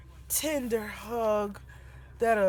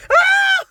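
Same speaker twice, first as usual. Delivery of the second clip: very high loud shriek; very high loud disgusted scream; 15 dB louder than usual. Retold or imitated. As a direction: shriek at the top of your lungs. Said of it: "The top of my lungs".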